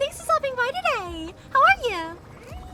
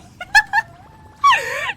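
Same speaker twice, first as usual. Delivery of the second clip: loud laugh; 11 semitones higher, 11 dB louder than usual. Real, not acted. After retelling game grief.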